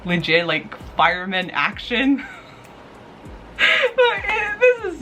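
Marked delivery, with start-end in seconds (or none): 3.6-5.0 words broken up by high laughter; giddy laughing fangirl. Real, not acted. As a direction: talk while cracking up. Laughing about fireman action.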